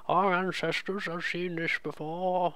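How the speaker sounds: put-on old person voice. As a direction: intone it like an old sage. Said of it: "I'm just doing a generic old person voice".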